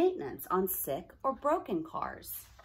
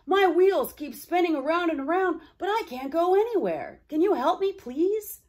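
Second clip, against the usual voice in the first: high, loud, desperate plea; pleading stuck streetcar; 10 semitones higher, 8 dB louder than usual. Acted. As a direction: beg for help, up high and loud. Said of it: "Rex exclaimed".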